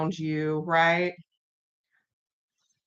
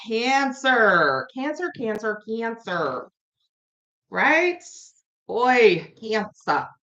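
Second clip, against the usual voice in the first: put-on higher, playful Long Island accent; 4 semitones higher, much slower than usual. Tarot reader calling the next sign, in a Long Island accent. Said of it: "that Long Island accent".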